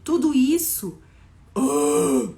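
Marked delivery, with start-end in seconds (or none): 1.5-2.3 loud, low growled ahh; a big, heavy ahh showing huge size; low, forceful vocal swell; expressive bigness noise. Shown as a demonstration. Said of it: "falou junto com a palavra big".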